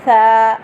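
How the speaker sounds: "सा" without a whistle, loud and clear; tongue between the teeth for "सा"; model letter. Shown as a demonstration. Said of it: "सा में सीटी नहीं है". ("सा" बिना सीटी के, ज़ोर से)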